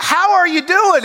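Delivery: high, pushed greeting with a mock edge; insistent greeting, mock loud. Brer Rabbit greets the tar baby a third time, mock insistent. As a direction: repeat the hello harder, as if ignored. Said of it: "I'm telling you".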